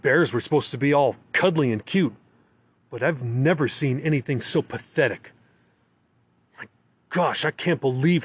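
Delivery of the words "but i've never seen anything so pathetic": plain reading voice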